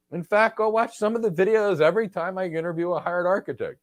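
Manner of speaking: ordinary delivery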